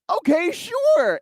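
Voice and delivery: high, drawn-out mock agreement; hammed-up, stretched-out yes; over-eager agreement. Retelling his eager agreement.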